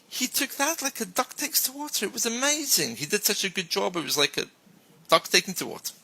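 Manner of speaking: high-pitched, thrilled praise; gushing praise. Shown as a demonstration. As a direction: gush about his talent like you're amazed. Enthusiastic praise example again.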